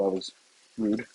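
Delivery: ordinary talk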